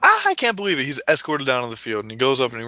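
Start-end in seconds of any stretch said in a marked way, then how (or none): 0.0-0.8 put-on high, excited kid voice; thrilled young boy. Billy picked from the crowd by the clown, thrilled. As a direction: squeal it like an overjoyed child. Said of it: "And so he, Billy".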